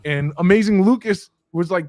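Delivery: usual speaking voice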